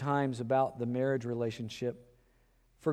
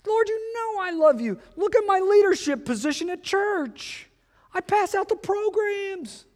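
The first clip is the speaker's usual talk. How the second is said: frantic believer; 3 dB louder than usual. Retold or imitated. Believer protests, highest pitch.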